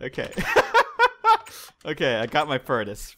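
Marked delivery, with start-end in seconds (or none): 0.4-1.6 high laugh. Real, not acted. Laughing after bickering.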